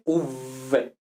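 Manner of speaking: "V" buzzed with teeth on lip; voiced v. Shown as a demonstration. Teacher shows v versus f for class. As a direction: buzz a voiced "V". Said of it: "es como una F, pero en vez de F".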